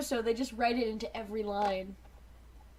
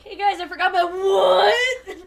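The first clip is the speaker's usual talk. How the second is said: mock outraged shout; 7 semitones higher, 11 dB louder, slower than usual. Optimus walking in on them.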